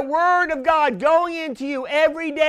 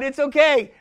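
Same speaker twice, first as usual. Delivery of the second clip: ordinary delivery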